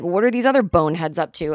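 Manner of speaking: ordinary talk, nothing marked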